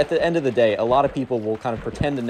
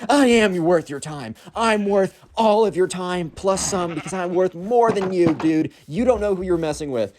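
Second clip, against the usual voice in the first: slow, higher mocking taunt; taunting emo kid; 7 semitones higher than usual. Emo kid taunts Hank before his friends, slow mock sneer. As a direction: sneer it like a teen showing off.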